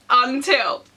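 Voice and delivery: ordinary talk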